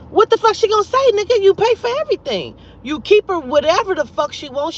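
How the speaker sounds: high, loud worked-up rant; exasperated rant. Real, not acted. Mocking a friend's cheating logic, high loud exasperation. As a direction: throw it back at him in disbelief. Ranting about his wife's silence.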